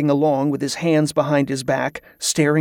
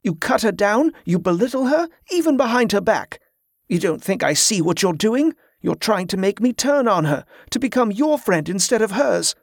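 mild narrated anger, pitched up; angry retort; 6 semitones higher than usual. A narrator's reading. Boy defending Allie to Carla, mildly angry. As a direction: accuse her, heated but understated. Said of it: "Jordan angrily retorted".

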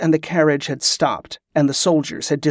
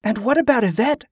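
higher-pitched questioning voice; worried young woman's question; 7 semitones higher than usual. A narrator's reading. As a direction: ask it high, with concern. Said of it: "Kerla asked".

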